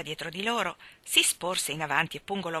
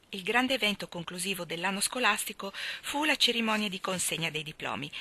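ordinary delivery

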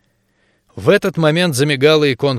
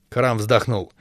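ordinary delivery, nothing marked

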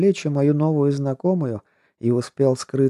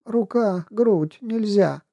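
gasping protest; 8 semitones higher, slower than usual. Acted. Half-drowned girl gasping protest.

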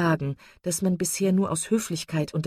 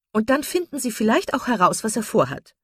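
high, raised, eager voice; calling out; 6 semitones higher, 5 dB louder than usual. A narrator's reading. Misses Jennings wants Brandon's secret found out, calling out eagerly. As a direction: egg him on, pitched up. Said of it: "rief Misses Jennings".

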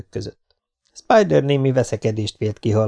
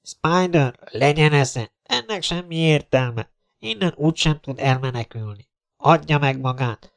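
raised-pitch, measured appeal to surrender; captain on loudspeaker; 4 semitones higher than usual. Acted. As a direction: reason with him firmly from outside. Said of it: "újra felcsendülő hangszóró recsegést". (hangszórón győzködő százados)

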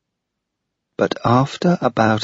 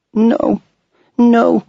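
put-on high, loud child's refusal; a little girl crying out no; 14 semitones higher, 5 dB louder than usual. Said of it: "she cried".

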